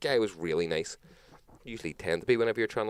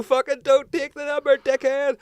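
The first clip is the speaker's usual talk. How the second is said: high, loud scolding shout; yelling at past self; 9 dB louder than usual. Real, not acted. Shouting at his naive younger self, mock furious. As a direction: bellow the warning you wish you'd heard.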